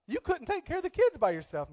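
high-pitched, loud retold protest; mock objection. Sick couple arguing over the emergency room, mock objection. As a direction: object in an imitated high, insistent voice. Retold back-and-forth argument with wife.

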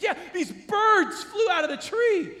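high-pitched mock panic; freaking out. Wife telling husband about the birds, freaking out. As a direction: imitate a worked-up wife explaining herself. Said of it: "freaking out".

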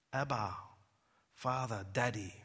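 tender hush. Acted. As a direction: breathe the names like a child. Quiet intimate naming of God.

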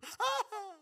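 squeaky imitated laugh; a high falsetto burst of laughter; mock high laugh. Pastor imitating the banker's laugh, squeaky. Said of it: "Probably didn't quite sound like that".

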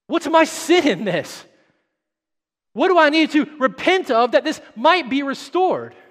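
high, loud pleading sermon; impassioned preacher voicing David's humble questions; preaching at full force. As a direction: plead it from the pulpit. Preaching climbs again.

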